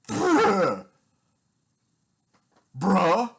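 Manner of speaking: loud, high, fed-up bruh; loud disbelieving bruh; exasperated bruh. Real, not acted. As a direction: throw up your hands at them. Exasperated at imagined critic.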